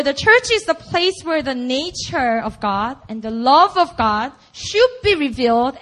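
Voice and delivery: normal talking voice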